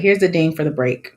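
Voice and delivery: ordinary talk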